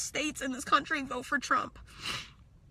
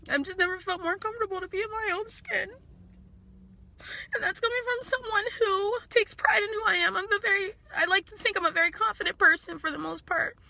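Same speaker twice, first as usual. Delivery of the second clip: real tearful, high-pitched voice; crying; 8 semitones higher than usual. Real, not acted. Uncomfortable in own skin, speaking to viewers through tears, crying. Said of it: "I'm really sorry that I'm crying".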